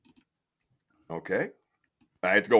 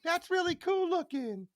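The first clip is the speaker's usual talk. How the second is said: much higher, airy mock gush; mock impressed viewer. Host mocks what viewers would say, high and gushing. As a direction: parody a shallow impressed viewer. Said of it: "just to go".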